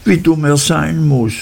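put-on a fretful girl who wants him gone; girlish Dora, petulant. A narrator's reading. Dora voiced higher. (höher, kindlich und quengelig)

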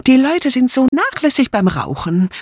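high-pitched, fussy complaint; disapproving. A narrator's reading. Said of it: "bemerkte Dora Banner missbilligend".

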